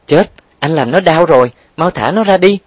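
high, panicked plea; alarmed cry. A narrator's reading. Woman begging him to release the boy, alarmed. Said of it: "vội kêu lên hốt hoảng".

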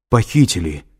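a touch of a breaking voice. A narrator's reading. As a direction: force out the bad news, understated. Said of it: "ответил Лэнгдон срывающимся голосом".

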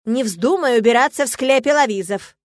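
loud, angry scolding; furious dean laying down the law; barked order. A narrator's reading. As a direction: scold a student at full voice. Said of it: "рявкнул магистр Шарти".

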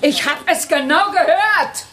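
high, sharp indignant cry; indignant outburst. Acted. Teacher scolding a pupil who insulted her, high and indignant. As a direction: catch him out, outraged.

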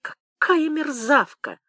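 drawn-out, high-pitched spiteful marvelling; spiteful admiration. Acted. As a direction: stretch it out in wicked admiration. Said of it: "со злым восхищением".

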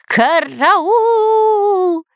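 high cartoon panic wail; wailing for help. Spy whirled in the air, high panicked wail. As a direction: howl it high in terror. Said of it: "вопил Дырка".

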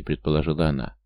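plain reading voice

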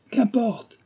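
exclamation furieuse, bien plus aiguë; fureur. A narrator's reading. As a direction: balaie l'objection avec colère. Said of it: "lui répondit-il en fureur". (colère qui jaillit dans l'aigu)

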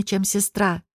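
plain reading voice

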